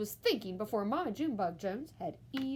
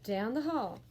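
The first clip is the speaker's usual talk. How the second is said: ordinary delivery, nothing marked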